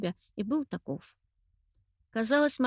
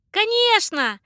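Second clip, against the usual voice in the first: high loud calling out; calling after; 12 dB louder than usual. A narrator's reading. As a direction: shout after someone flying off. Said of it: "сказала вдогонку Анечка".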